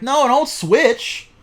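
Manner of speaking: loud, high, drawn-out plea; loud mock protest. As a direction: wail it out in mock outrage. Acted protest to game makers.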